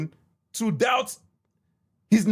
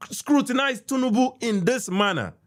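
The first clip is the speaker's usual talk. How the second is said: usual speaking voice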